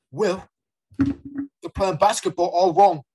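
loud, high, drawn-out, faintly British scolding; Carlton Banks, slightly British. Acted. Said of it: "That was a little bit British".

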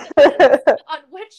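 loud laugh through the words; words bubbling over with loud laughter. Real, not acted. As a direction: speak through a burst of laughter. Laughing during a joke.

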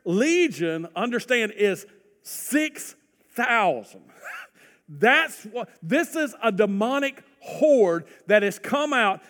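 full-force pulpit preaching. Acted. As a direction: preach it with mounting fire.

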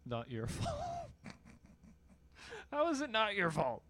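laughing through the words; laughing mockery. Real, not acted. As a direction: repeat it while cracking up. Mocking the excuse, laughing.

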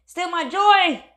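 slow, then rising high and loud in defiance; defiant self-talk. Pretend. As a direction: refuse to let them win, build up. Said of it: "they pushed my buttons".

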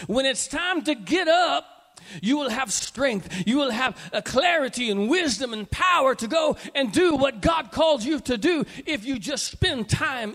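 loud, high, rising exhortation with a shout; full-force preaching. Real, not acted. Preacher urging congregation to sit at Jesus' feet, shouting. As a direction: build to a ringing call.

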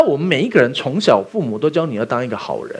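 ordinary talk

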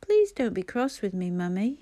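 ordinary delivery

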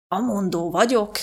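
slow, lightly voiced heavy man, admiring; a hint of a stout man savouring the thought; lightly voiced stout landlord, slow and admiring; admiring Mr Harris. A narrator's reading. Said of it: "mondta mister Harris csodálattal".

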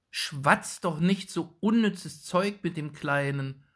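a fed-up mother cutting in; annoyed mother. A narrator's reading. Shoemaker's wife impatiently telling the old woman off. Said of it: "sagte endlich die Frau des Schusters".

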